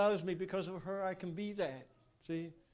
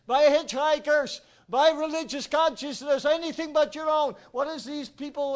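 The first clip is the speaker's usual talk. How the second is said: loud, higher, driving sermon voice; fiery preaching; 5 semitones higher, 7 dB louder than usual. Acted. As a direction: hammer the point home to the congregation. Preaching builds to full force.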